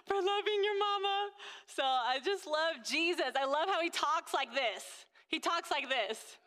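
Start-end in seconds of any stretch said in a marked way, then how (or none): 0.0-1.3 higher, tender, teary voice; thanking her son through emotion; tearful thanks. Retold or imitated. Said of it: "I was like".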